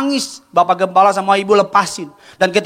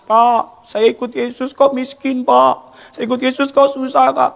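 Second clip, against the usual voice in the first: retold plea, higher, with a touch of tears; imitating a crying woman; 6 semitones higher, slower than usual. At the baptism pool a poor woman complains to the pastor, half-tearful plea. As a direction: mildly mimic a weeping complaint to the pastor. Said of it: "Di kolam baptisan nangis".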